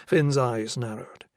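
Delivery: ordinary delivery, nothing marked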